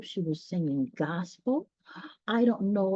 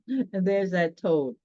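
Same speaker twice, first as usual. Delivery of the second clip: ordinary delivery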